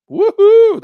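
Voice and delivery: very high, loud cry of joy; excited woohoo. Real, not acted. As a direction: whoop it out with glee. Cheering share numbers.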